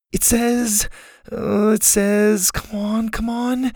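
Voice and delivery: put-on high, anxious girl's voice; hesitate, then urge the page on under your breath; high-pitched worried searching; frantic reading. A narrator's reading. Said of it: "Susie was frantically reading".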